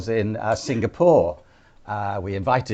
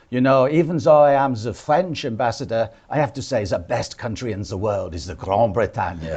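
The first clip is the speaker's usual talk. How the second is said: hammed-up French accent; pseudo-French accent. British ambassador posing as the French one for fun, pseudo-French accent. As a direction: imitate a diplomat faking a French accent. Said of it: "talking in a sort of pseudo-French accent".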